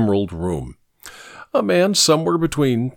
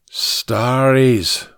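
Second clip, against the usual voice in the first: Irish "Starries" with the word drawn out long; long, Irish-accented "Starries". Acted. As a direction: stretch "Starries" in a broad Irish vowel. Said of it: "his Irish accent turning it into a longer word".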